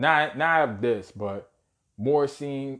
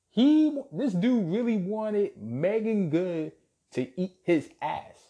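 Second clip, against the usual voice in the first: ordinary talk, nothing marked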